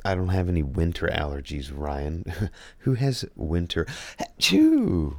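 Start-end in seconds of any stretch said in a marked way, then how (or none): 4.2-5.2 big explosive sneeze; loud sneeze. Real, not acted. Third sneeze.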